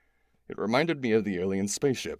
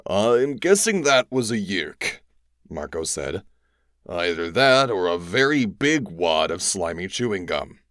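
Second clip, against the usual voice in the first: wry joke; 6 dB louder than usual. A narrator's reading.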